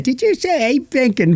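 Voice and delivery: half-hearted cheeky little British voice; wrong accent. Retold or imitated. Host imitating the wrong accent he first used. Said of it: "totally different accent".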